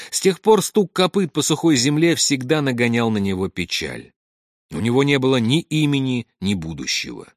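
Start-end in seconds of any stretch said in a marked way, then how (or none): none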